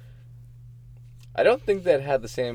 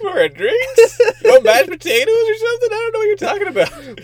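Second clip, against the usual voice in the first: very high, squeaky put-on bafflement; high-pitched comic confusion; mock bafflement; 6 dB louder than usual. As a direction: play a baffled order-taker way up high. Acted baffled drive-through worker.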